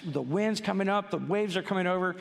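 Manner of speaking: ordinary talk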